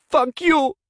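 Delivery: high, cracking furious outburst. A narrator's reading. Woman leaving her lover in tears, voice cracking. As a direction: break on it, fury close to tears. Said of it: "her voice cracking".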